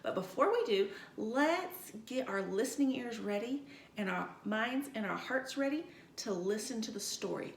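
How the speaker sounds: ordinary talk, nothing marked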